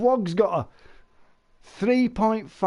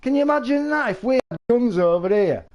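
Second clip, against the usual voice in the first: ordinary delivery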